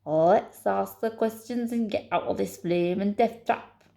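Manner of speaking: put-on emphatic Scottish accent; growing fed up, in a Scottish accent. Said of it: "I've got to do in a Scottish accent".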